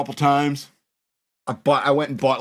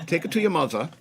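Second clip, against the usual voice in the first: higher mock German accent; German lady's accent; 5 semitones higher than usual. European lady sends the kid to his mother, mock German accent. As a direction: imitate a German woman, accent on every word. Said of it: "I don't know why she's German".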